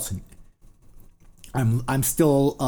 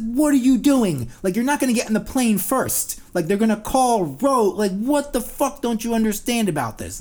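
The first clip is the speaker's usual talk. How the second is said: mock yelling; 11 semitones higher, 6 dB louder than usual. Ranting at passengers lining up early, exasperated yell. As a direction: yell at them like they're idiots.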